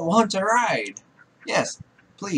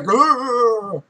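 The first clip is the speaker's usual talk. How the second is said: loud, higher-pitched imitated growl; mock growl; 6 semitones higher, 5 dB louder than usual. Player imitating the animal in the game, mock growl. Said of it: "He's like".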